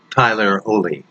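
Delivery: plain reading voice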